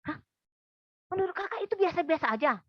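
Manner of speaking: high, loud, incredulous; a retold friend who can't believe what she heard; half-acted shocked protest; indignant disbelief.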